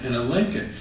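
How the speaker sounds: ordinary talk, nothing marked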